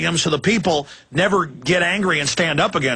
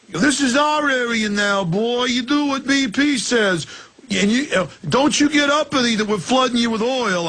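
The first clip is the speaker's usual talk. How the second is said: put-on Italian mobster accent; 7 semitones higher than usual. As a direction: lean on it like a mob heavy. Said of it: "the Italian mobster accent".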